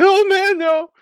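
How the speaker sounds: high, loud whiny wimp voice; wussy nice guy. Retold or imitated. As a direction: play the timid pushover. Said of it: "it's so funny 'cause he's like".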